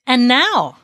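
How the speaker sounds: loud, high royal call; commanding. A narrator's reading. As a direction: seize the judge's attention. King turns on the judge.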